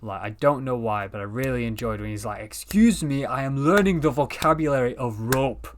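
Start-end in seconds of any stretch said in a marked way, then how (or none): 2.4-5.7 a lightly put-on quirky accent, a little louder; quoting the Doctor in a mangled accent; awful accent impression. Friend quoting the Doctor's line, mild put-on accent. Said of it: "I apologize for that awful accent".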